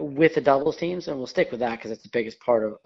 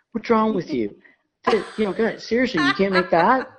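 mock-exasperated partner, pitched higher; nagging partner; 7 semitones higher than usual. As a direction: mimic a partner griping after each miss. Partner nagging after every shot.

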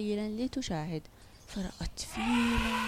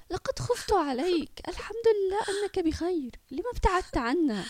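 high-pitched, emotional voice of someone crying; tearful; 9 semitones higher, 4 dB louder than usual. A narrator's reading. Mother hugs the son she had lost, tearful. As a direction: pour out relief and worry, tearfully. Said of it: "ثم ضمته وهي تبكي".

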